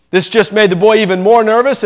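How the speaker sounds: usual speaking voice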